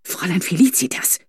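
whispered sharp warning; hissed whisper. A narrator's reading. Governess hissing a warning at Felicitas, whispered. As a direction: hiss her name under your breath. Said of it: "zischte Fräulein Corbinian leise mahnend".

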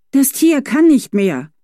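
slow, high, loud insistence; firm insistence. A narrator's reading. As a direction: repeat it as plain fact. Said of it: "stellte sie wiederholt fest".

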